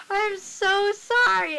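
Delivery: loud, high, drawn-out retold apology; imitated mortified apology. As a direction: wail the apology in mock mortification. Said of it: "she's, like, laughing".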